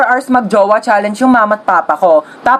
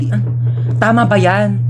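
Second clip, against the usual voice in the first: normal talking voice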